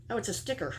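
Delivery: ordinary talk, nothing marked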